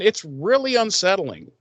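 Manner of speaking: ordinary talk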